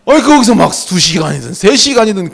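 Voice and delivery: normal talking voice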